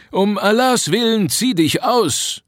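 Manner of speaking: put-on high, slow, pleading old man's warning; anxious warning. A narrator's reading. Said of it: "warnte der wohlmeinende Alte". (hohe, langsame, besorgte Warnung eines alten Mannes)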